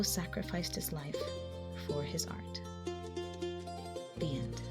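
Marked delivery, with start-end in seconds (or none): none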